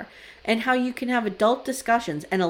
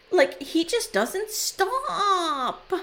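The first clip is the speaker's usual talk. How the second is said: mock crying whine; 8 semitones higher, much slower than usual. Imitating her crying to producer.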